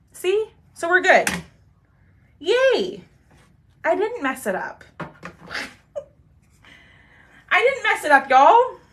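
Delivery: high, bright cheer at getting it right; excited relief. Real, not acted. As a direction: celebrate like you nailed it. Relieved it worked, celebrating.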